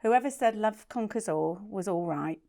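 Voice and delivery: low, slightly formal reading voice; love letter read-out. Real, not acted. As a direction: read a love note aloud, low and steady.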